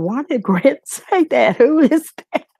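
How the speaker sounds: baffled and amused, rising to a squeak; giggling who-is-that; puzzled exclamation. Retold or imitated. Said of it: "I kept saying".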